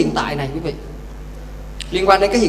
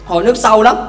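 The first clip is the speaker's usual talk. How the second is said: normal talking voice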